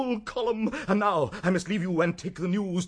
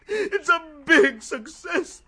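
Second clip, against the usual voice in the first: high, tearful, laughing sobs; tears of joy breaking through laughter; crying with joy; 7 semitones higher than usual. Acted. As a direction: weep through the happy news. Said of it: "burst into tears".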